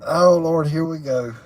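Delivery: usual speaking voice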